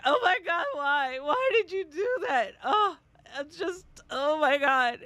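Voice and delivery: high, loud, tearful "why" in imitation; hammed-up sobbing despair at a coworker; mock wailing. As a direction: replay your dramatic meltdown, loudly whining. Re-enacts reaction to coworker's blunder.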